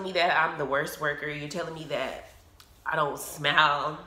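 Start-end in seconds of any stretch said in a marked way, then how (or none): none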